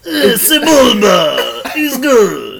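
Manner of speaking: high, very slow Toydarian drawl; Watto impression. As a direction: gloat slowly like a junk dealer.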